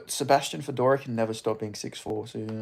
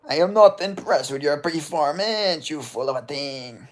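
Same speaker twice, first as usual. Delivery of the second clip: GSP impression; 4 dB louder than usual. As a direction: play the unimpressed champion. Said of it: "Imagine, like".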